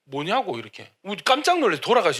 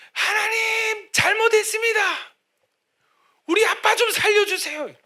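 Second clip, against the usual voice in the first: crying out high and loud to God; desperate shouted prayer; 5 dB louder than usual. Retold or imitated. Son on his knees outside surgery, shouting his prayer. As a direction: beg God loudly for father's life. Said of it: "소리를 지른 거예요".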